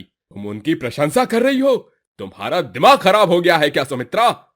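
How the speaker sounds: loud, fast angry rebuke; angry scolding. Acted. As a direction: snap at your wife, fast and loud. Husband scolds wife angrily. (गुस्से में तेज़ ऊँची डाँट)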